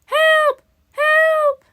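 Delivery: loud, high-pitched cries for help; very loud call. A narrator's reading. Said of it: "then very loudly".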